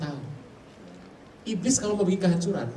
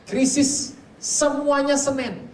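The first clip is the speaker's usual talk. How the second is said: much higher, slow and weighty; slow emphatic declaration; 8 semitones higher, 4 dB louder, much slower than usual. Real, not acted. Preacher warning the congregation, slow and high. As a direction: lean on each word, slowly. Preacher stresses Monday crises.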